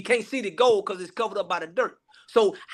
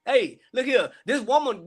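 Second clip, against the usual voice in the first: ordinary talk, nothing marked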